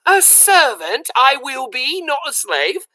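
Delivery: high, slow, proud declaration; high earnest little voice; satisfied girl. A narrator's reading. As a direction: announce it solemnly.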